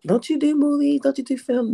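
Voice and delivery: imitated puzzled questioning, pitched high; high-pitched mimicry of nosy questioners; mock wondering. Imitating people asking why she works background, high and wondering. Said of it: "was wondering what I was doing there".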